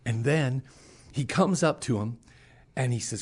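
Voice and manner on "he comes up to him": ordinary delivery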